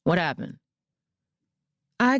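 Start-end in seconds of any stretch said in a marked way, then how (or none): 0.0-0.6 serious. A narrator's reading. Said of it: "his voice growing serious".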